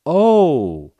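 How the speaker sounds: loud, high, drawn-out "oh"; realizing oh. Retold or imitated. Clinic staff realize the patient's mistake, loud high "oh". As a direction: let a big "oh" of realization swell.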